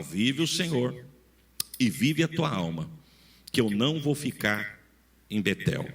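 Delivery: ordinary talk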